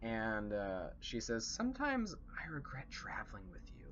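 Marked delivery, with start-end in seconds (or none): 1.5-3.9 quiet aside. Acted. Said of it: "low enough so that the orcs on the other side of the bridge don't hear".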